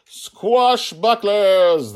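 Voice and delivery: very loud, long, high battle cry; triumphant yell stretched right out; joint shout. A narrator's reading. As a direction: shout it like two heroes together. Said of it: "they both shouted together".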